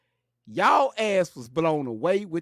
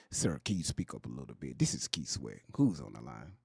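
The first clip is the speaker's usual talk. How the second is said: much lower, softer smooth radio host; late night radio DJ voice; 12 dB quieter than usual. Retold or imitated. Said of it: "[name] having a conversation".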